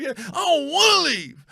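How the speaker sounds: high, slow whining; whiny complaint. Retold or imitated. Fan whining to his grandmother, high and slow. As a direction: whine like a kid told to go home. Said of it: "he, like, quit his heart like".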